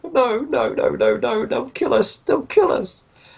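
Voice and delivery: high-pitched mock-crying plea; tearful begging. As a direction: beg for your life in a high whimper. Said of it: "he starts crying tears".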